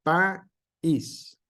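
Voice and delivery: país with a clear break between a and í, stress on í; hiatus pronunciation. Shown as a demonstration. Teacher showing the correct hiatus to students. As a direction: say país as pa-ís, two syllables. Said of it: "la pronunciación y dice".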